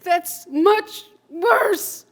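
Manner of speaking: stretched out, loud and high; slow anguished cry. Acted. Shaken man after the vision, slow anguished cry. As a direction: let the horror drag each word.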